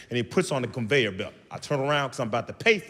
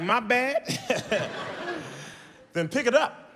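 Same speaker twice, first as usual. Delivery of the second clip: laughing; 8 semitones higher than usual. Real, not acted. Laughing apology to the mom.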